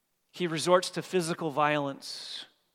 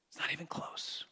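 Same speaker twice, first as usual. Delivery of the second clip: whispered aside; 11 dB quieter than usual. Real, not acted. Preacher to his congregation, a hushed whisper. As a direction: drop to a whisper, much softer. Preacher stressing the gap in debts.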